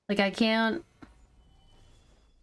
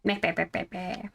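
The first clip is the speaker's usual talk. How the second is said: mocking blah blah; 3 dB quieter, faster than usual. Mocking babble while playing.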